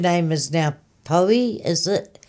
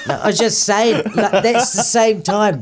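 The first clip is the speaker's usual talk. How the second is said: laughing while explaining; 3 semitones higher, 4 dB louder than usual. Real, not acted. [name] laughing as she explains her story. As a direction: talk through a giggle.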